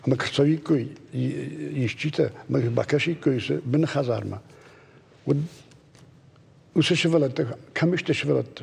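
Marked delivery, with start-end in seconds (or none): none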